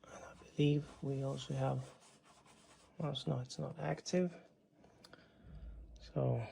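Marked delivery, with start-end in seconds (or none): 0.0-4.3 quick whispered aside to himself; whispering. Real, not acted. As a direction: hush it, mostly breath, fast.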